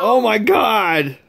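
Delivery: high, loud, drawn-out gasp of joy; excited shout. Real, not acted. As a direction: shout it out, overjoyed. Parent reacts to the gift.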